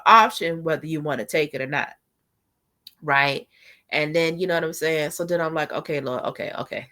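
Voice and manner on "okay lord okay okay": calming herself down. Retold or imitated. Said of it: "'cause I'm overreacting".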